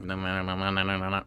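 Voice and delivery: rapid run-on mumble; mock fast talk. Podcaster imitating own fast talking at work, rapid mumble. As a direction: rattle it off as one indistinct blur. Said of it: "definitely fast-talking people".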